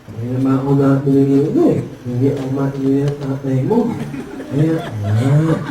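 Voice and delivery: low, muffled ngongo voice; nasal-speech characters. Acted. Two ngongo characters discussing the order, low nasal speech. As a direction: drone it in garbled cleft-palate speech. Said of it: "ngongo din".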